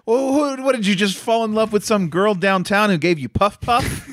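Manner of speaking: high, shrill mock outrage; high mock fury; mock irate. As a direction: play the jealous girlfriend, hammed-up. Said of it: "gets overtly irate and is like".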